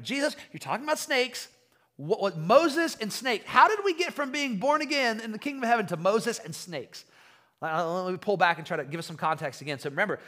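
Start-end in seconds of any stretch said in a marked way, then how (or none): 0.0-7.0 high-pitched, incredulous questioning; baffled disbelief. Acted. Baffled listener questioning Jesus about Moses and snakes, incredulous. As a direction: act out total bewilderment, pitch up and louder.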